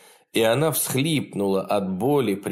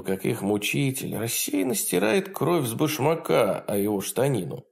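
ordinary delivery, nothing marked

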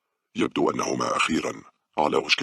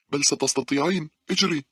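high, insistent yell; screamed encouragement; 14 semitones higher, 4 dB louder than usual. A narrator's reading. Girl holding doors screams at her friend, insistent. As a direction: shout back to push a friend on. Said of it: "صرخت بها مونيكا".

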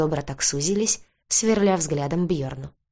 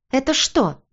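high, outraged demand; indignant roar; 8 semitones higher, 3 dB louder than usual. A narrator's reading. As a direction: raise the pitch sharply in an indignant outburst. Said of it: "взревела она". (возмущённый возглас на повышенном тоне)

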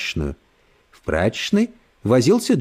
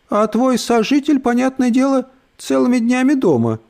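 sugary condescending lady; 10 semitones higher than usual. Acted. Брит Мари язвит про сожителя.

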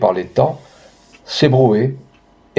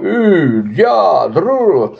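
calling to horses; 7 semitones higher, much slower than usual. Acted. Farmer urging his horses.

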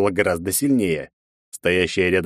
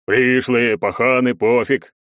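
louder booming guard voice; dopey deep voice; 4 semitones higher, 4 dB louder, slower than usual. A narrator's reading. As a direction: boom it out like a dim guard. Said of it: "басом прогудело".

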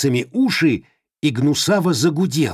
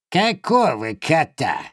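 slow, sly crone; sly feigned innocence; 5 semitones higher than usual. Acted. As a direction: play dumb slyly. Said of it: "хитро прищурилась". (хитрая старуха)

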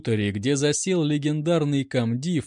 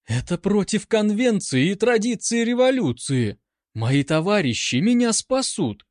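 indignant protest; 7 semitones higher than usual. A narrator's reading. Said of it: "возмущалась".

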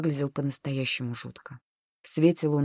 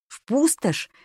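high, alarmed echo of the words; startled, much higher repeat; incredulous question; 10 semitones higher, slower than usual. A narrator's reading. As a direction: pitch it sharply up in disbelief. Panicked repeated question in narrated thought.

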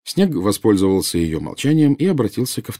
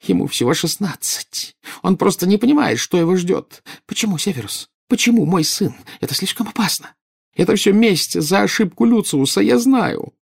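high-pitched, frantic weeping voice; sobbing; 13 semitones higher, 5 dB louder than usual. A narrator's reading. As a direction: protest through tears, pitch far up. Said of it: "Нарцисса заплакала навзрыд". (навзрыд, с отчаянием)

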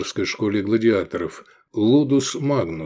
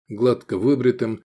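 usual speaking voice

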